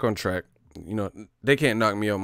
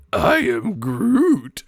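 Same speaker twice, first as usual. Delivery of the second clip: Groot impression; 8 semitones higher, 5 dB louder, much slower than usual. Streamer imitating Groot for chat, slow. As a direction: drag it out like Groot. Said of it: "Jeff was like".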